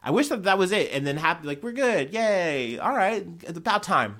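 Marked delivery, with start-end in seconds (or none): 1.6-4.1 high, bright mock celebration; cheery. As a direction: sing out a happy yay. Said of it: "That's what I would say".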